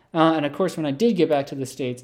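ordinary delivery

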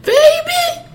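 very high, loud grandma voice; exaggerated falsetto grandmother on the phone; grandmother impression. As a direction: squeal it like a mock concerned grandma. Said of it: "my grandma, she's on the phone".

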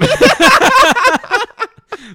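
loud, high burst of laughter; big real laugh. Real, not acted. Friends cracking up at a joke, loud laughter. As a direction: crack up loudly.

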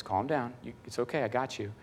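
soft, low comforting murmur; calming reassurance. Retold or imitated. God comforts Tim during grandma's phone call, soft and low. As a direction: reassure them quietly and gently. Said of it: "comes all over me again and says".

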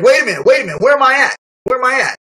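high, loud wait a minute, where am I; confused panic. Retold or imitated. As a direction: snap out of a daze in alarm. Dazed after the hit, confused.

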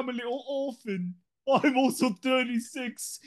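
high-voiced mock orphan; Dickens character. Player introducing a pretend orphan, hammed-up Dickens voice. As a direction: play a pitiful London urchin, pitched high. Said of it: "Are you a Charles Dickens character?".

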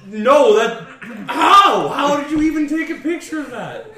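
high, loud laughing shock; laughing disbelief. Retold or imitated. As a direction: gasp it out while cracking up.

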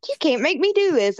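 high-pitched imitated protest; mock defiance. Girl runs up to teacher, mock defiant. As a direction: lightly imitate a student challenging her teacher. Voicing student challenging the teacher.

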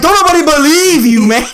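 high, loud dismissive jab through laughter; mock dismissal. Mom brushing off her son's pain, laughing. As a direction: brush off his complaint while laughing.

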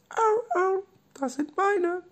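tiny, very high squeak; squeaky Robby voice. Acted. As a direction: squeak like a small stuffed animal. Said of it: "Robby wieder". (hohe, piepsige Stimme)

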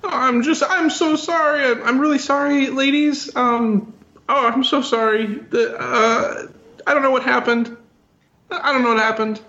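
high-voiced, flustered [name] stalling with ums; [name], nervous apologizer. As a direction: apologize awkwardly in a high falsetto.

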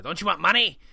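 high, loud nasal mocking whine; mock the fans through the nose; nasally voice. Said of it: "saying it in, like, a nasally voice".

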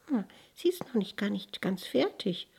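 soft, high flower voice; little flower. A narrator's reading. Tiny flower modest about its melody, soft-voiced. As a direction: speak small and softly, pitch lifted. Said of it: "sprach da die kleine Blume".

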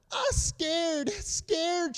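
put-on high, small voice of a scared toddler; imitating toddler. Father voicing his scared daughter, high and small. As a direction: play the frightened little girl, lightly. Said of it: "she says".

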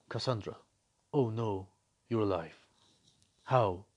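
low, soft, limp mock of the father; cringey father line. Mocking the father's reunion line, low, soft and limp. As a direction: drop low and flat to mock a lifeless reading. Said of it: "how cringeworthy that moment is".

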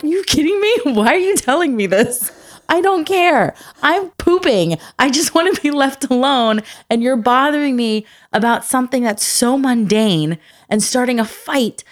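high-pitched mock exasperation; exasperated. Mother to child interrupting her bathroom break, hammed-up exasperation. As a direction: protest like a fed-up parent.

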